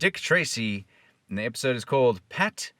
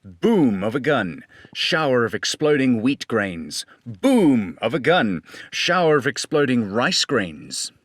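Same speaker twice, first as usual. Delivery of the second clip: loud, punchy radio announcer; hammed-up vintage announcer; announcer read; 2 semitones higher, 8 dB louder than usual. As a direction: boom it out like an old radio spot. Reads radio sound cues aloud.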